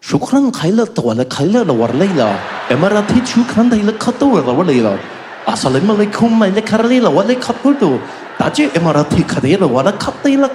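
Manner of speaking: mock Arabic gibberish with a rolling chanty Arabic lilt. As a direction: rattle off mock Arabic safety-video talk. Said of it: "the video comes on".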